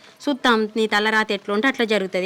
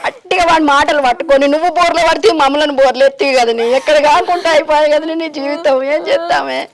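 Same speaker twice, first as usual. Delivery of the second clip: raised, high-pitched lament; distressed mother's aggrieved wail; anguished reproach; 4 semitones higher, 5 dB louder than usual. Acted. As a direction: scold through grief at a ruined life. Mother laments daughter's trusting that man.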